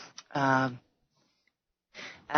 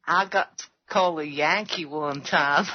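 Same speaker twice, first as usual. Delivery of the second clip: imitated Georgia accent; 4 semitones lower than usual. Australian copying a Georgia man, mock Southern accent. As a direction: copy a Georgia man's way of talking. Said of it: "can I just copy that?".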